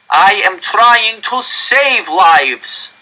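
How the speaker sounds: very slow, high, loud roar. Acted. Director roaring back at the furious crowd. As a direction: roar over the crowd's rage. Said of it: "the director roared".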